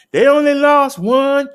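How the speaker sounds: high, loud "lost" sung out with relief; triumphant call. Acted. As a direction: cheer it like good news. Triumphant punchline in stats report.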